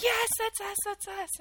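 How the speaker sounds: high-pitched eager call, retold; calling back. Captives calling back to their rescuers, high retold call. Captives answer soldiers' shouted question.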